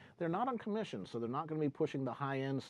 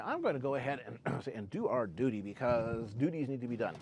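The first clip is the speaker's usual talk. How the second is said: normal talking voice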